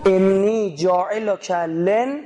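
slow, drawn-out chanted Arabic; solemn slow recitation of the verse; Quranic recitation. Acted. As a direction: intone the verse slowly like reciting scripture.

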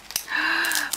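a soft, high, falling gasp; quiet gasp at the next treat. Real, not acted.

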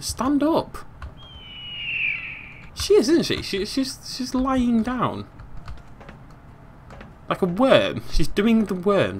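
high, baffled disbelief; incredulous. Real, not acted. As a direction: sound utterly baffled. Baffled at crawling character.

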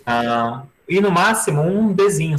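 ordinary talk

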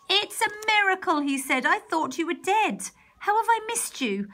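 put-on a father amazed his children live; overjoyed. Father greeting his lost children, high and joyful.

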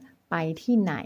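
ไปที่ไหน drawn out slowly; slow, careful question. Shown as a demonstration. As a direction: stretch out ไปที่ไหน for the learner. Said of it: "ถามว่า". (พูดว่า ไปที่ไหน ช้าๆ ชัดๆ)

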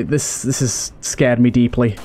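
usual speaking voice

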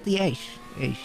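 normal talking voice

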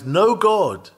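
a high, loud cry of denial; mocking cry. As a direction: shout it as the scoffing atheist. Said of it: "The atheist cries".

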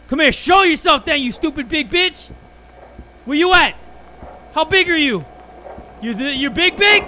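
high, loud trash talk covering fear; scared bravado. Real, not acted. Streamer taunting the hidden monster out of fear, high shouted bravado. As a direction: shout threats to hide the fear. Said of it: "because I'm so freaking scared".

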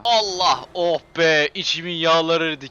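loud, gleeful cry of relief. Real, not acted. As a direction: shout out the satisfaction. Relief after hitting a car. (yüksek sesle rahatlama ve sevinç nidası)

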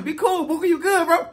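ordinary talk

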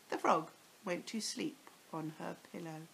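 ordinary delivery, nothing marked

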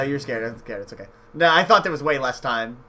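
ordinary delivery

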